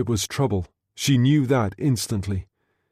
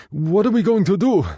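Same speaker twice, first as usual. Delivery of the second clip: higher, urgent and breathless; anxious Guido; 8 semitones higher, 3 dB louder than usual. Acted. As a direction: press for an answer, worried. Guido panicked.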